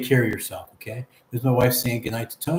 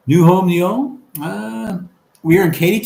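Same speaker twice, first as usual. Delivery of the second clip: excited; 6 semitones higher, 7 dB louder than usual. Real, not acted. Reading viewer's chat, excited.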